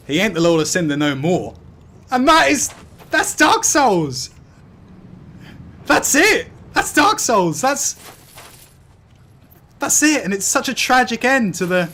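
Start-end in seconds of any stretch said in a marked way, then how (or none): 2.1-10.2 high, slow, emphatic cheer; exultant. Real, not acted. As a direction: revel in the win. Celebrating victory.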